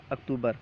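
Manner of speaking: اکتوبر as "aktoobar", without any m; no M sound. Shown as a demonstration. Teacher correcting the month name, no M sound. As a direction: drop the m in اکتوبر, softly. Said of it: "میم نہیں ہے".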